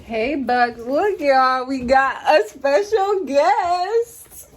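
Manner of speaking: high, loud and drawn out; excited calling out. Real, not acted. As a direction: sing out to the guest. Calling out to a guest.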